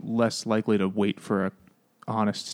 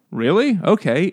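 higher, louder mock surprise; hammed-up keen "Really? Okay"; mock eager mom; 6 semitones higher, 5 dB louder than usual. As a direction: play it like an eager older woman. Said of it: "she's like".

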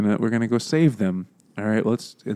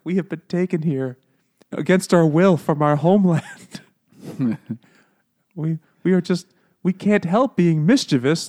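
higher-pitched, put-on little gnome voice; plaintive gnome; 4 semitones higher than usual. Gnomes explain their plight to the kids, plaintive. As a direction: plead the gnomes' case in mock earnest.

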